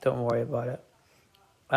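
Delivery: ordinary delivery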